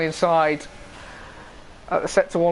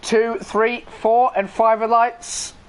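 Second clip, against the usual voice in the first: higher-pitched, excited start call; live race commentary; 7 semitones higher, 3 dB louder than usual. Real, not acted. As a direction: count the lights, then launch the race.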